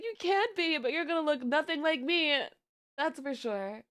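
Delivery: high, playful taunt; teasing. Retold or imitated. Aunt teasing niece about her model, high playful. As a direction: tease her in a silly voice.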